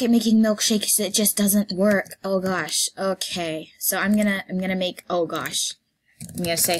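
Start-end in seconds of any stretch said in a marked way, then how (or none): none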